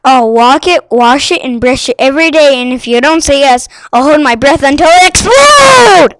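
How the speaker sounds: loud, rising into a shout; loud pleading child. A narrator's reading. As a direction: beg loudly, building to a yell. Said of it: "she promised".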